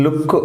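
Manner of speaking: లుక్ with a short oo and quick k; short vowel. Shown as a demonstration. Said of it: "ఇక్కడ లుక్ short ప్రొనౌన్సియేషనే". (లుక్ లో పొట్టి ఊ శబ్దం)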